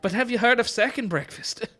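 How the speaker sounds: high, put-on comic lilt; joking character voice. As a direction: pipe it up as a jokey aside. Joke quote in accented character voice.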